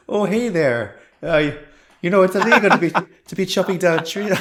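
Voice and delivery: put-on jokey Canadian accent slipping into Irish. Imagined guard telling off a tree chopper, Canadian accent. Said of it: "like, a Canadian accent".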